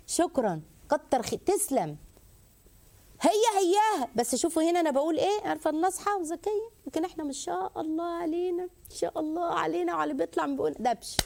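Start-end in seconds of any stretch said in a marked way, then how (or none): 3.2-11.2 high, teasing sarcasm; mock those who speak harshly; sarcastic mockery. Host mocks wives' harsh tongues, sarcastic.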